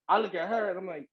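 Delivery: usual speaking voice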